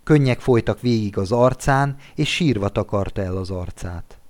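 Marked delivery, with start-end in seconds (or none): none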